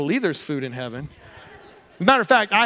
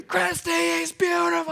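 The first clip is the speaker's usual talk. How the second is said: high, slow overjoyed call to his wife; replaying the moment he called out to his wife; joyful scream; 11 semitones higher than usual. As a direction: shout the good news across the room, high and slow. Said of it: "And I'm just screaming".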